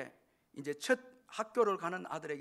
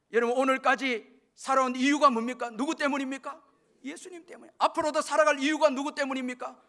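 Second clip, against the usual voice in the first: forceful preaching; 9 semitones higher, 10 dB louder than usual. Real, not acted. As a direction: press the congregation with ringing questions. Preacher questions the congregation. (높고 크게 외치며 묻는 설교조)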